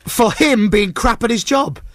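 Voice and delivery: high-pitched, drawn-out indignation; indignant. Real, not acted. Fuming about being told off by a guard, indignant. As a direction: let real annoyance raise your pitch. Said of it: "I'm so mad".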